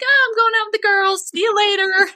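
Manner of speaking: high, sing-song fake-casual voice; breezy high voice to throw the husband off; innocent cover story. Lying to husband about mediums.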